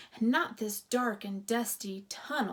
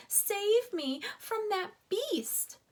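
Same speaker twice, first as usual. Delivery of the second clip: high, slow plea; pleading; 10 semitones higher, 4 dB louder, slower than usual. A narrator's reading. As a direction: drag out the begging. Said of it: "pleaded Ralph".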